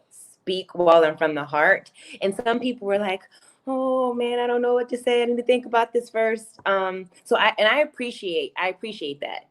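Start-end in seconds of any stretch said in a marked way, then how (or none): 3.7-6.6 higher-pitched mock hesitation; hesitant. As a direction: voice someone stalling to think it over. Voicing hesitant participants' reply.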